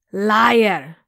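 a loud furious cry of "Liar!"; furious shout. Acted. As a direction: spit out the accusation, loud. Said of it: "she shouted".

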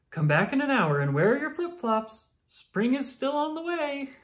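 high, eager promise; eager reassurance. Acted. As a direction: reassure them brightly. Groundhog reassures the town.

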